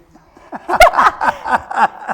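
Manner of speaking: big high burst of laughter; loud high laugh. Real, not acted. Reacting to forensic pathology.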